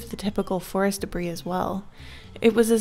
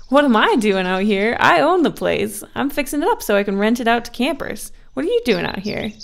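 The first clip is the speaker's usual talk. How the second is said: louder, hearty man calming them; reassuring woodcutter; 3 semitones higher, 6 dB louder than usual. A narrator's reading. Woodcutter reassures two frightened girls, hearty and calming. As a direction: soothe them, palms up, with a chuckle.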